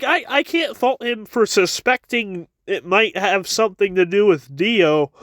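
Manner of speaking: ordinary delivery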